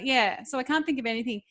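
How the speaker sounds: ordinary talk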